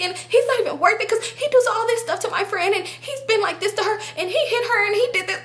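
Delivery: high, breathless run-on mimicked pleading; pleading her case. Friend explains the feud to the other girls, pleading. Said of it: "'cause you pleading your case".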